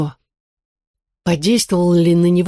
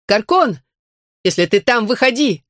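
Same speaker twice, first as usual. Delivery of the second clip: calls out high and sharp; shouting; 5 semitones higher than usual. A narrator's reading. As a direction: call into the dark room. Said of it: "крикнула она". (громкий окрик)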